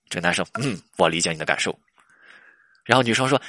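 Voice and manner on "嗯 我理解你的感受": low, unmoved stock reply; lowered, impassive acknowledgement; canned understanding. Acted. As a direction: play the boyfriend who answers by rote. Boyfriend's canned reply, acted.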